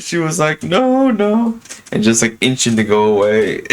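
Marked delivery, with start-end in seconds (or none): 0.6-1.6 high, timid mock refusal; imitating terrified old lady. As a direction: shrink back from the door, pitched way up. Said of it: "genuinely terrified".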